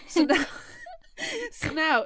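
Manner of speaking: ordinary delivery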